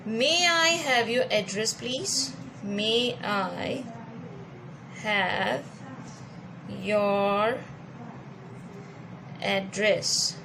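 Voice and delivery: May I have your address read slowly, each word separate; slow example read. Shown as a demonstration. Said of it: "address". (धीरे धीरे, हर शब्द अलग करके)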